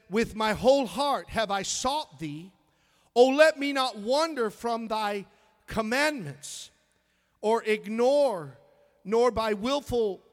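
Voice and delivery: loud, ringing Bible reading; scripture reading. Real, not acted. Pastor reading King James to the church, proclaiming. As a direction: declaim the verse.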